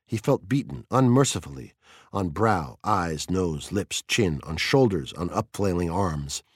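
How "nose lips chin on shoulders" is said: ordinary delivery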